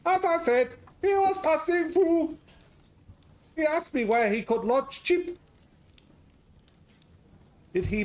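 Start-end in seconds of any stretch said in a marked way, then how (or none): 0.1-5.4 high, loud hammed-up Greek-Scottish accent; a jolly Greek cook with a Scottish lilt, up high and loud; garbled Greek-Scottish accent. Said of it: "Garbled Greek Scottish".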